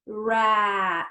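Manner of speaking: "Rat" stretched with a long vowel; blended slowly. Shown as a demonstration. Teacher blends rat for children, "Rat" slowly stretched. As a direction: draw "Rat" out in one slow blend. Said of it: "Blend it slowly".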